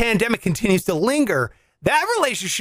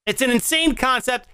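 ordinary delivery

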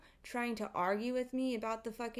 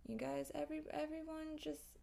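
normal talking voice

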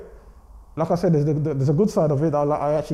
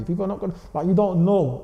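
usual speaking voice

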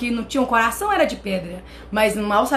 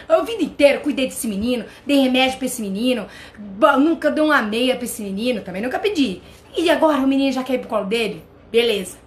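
normal talking voice

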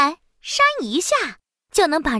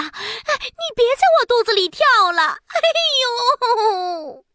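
pained groans; 6 semitones higher than usual. Acted. Princess with Wukong tumbling in her belly, groaning in pain. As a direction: wail in mock pain. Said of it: "疼得满地打滚".

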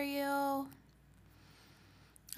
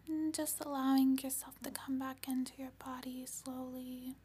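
guided meditation; faster than usual. Acted. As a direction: ease them back gently. Meditation guidance.

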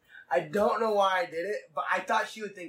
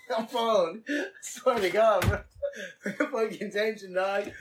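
laughing scolding; 3 semitones higher than usual. Real, not acted. Scolding co-host on phone, laughing.

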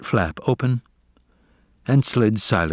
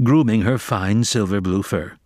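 ordinary delivery

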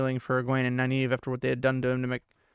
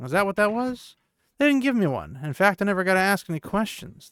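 higher, louder protesting; indignant complaint; 7 semitones higher, 5 dB louder than usual. A narrator's reading. Matt grumbling to Moraine that he got no interpreter, indignant. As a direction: raise the pitch in aggrieved protest.

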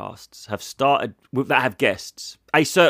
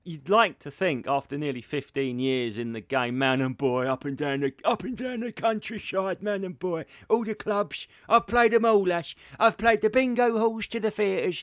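lightly voiced old club comic, higher; boastful veteran circuit comedian; old-timer comic voice; 6 semitones higher than usual.

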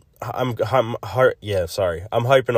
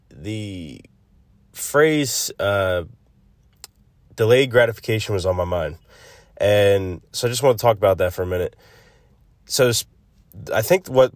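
normal talking voice

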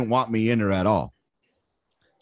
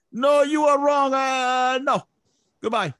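put-on Vietnamese accent; 13 semitones higher, 4 dB louder than usual. Sergeant major dismissing Lopez from the board, Vietnamese accent.

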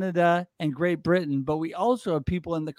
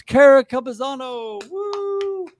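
higher, louder name call ending in a whoop; excited introduction; 8 semitones higher, 5 dB louder, slower than usual. Real, not acted. Host bringing on a good friend, excited introduction. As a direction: hype up the entrance with a high woo. Host calls guest onto show.

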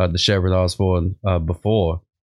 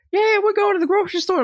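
squeaky party guest. Retold or imitated.

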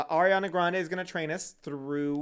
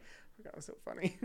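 whispered aside; 3 semitones higher, 12 dB quieter, faster than usual. Retold or imitated. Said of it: "I'm just like".

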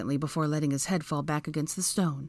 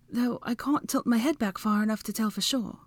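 higher, matter-of-fact voice; female character voice; 6 semitones higher than usual. A narrator's reading. Hermione judging the height above, in high voice. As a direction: speak it in a raised pitch.

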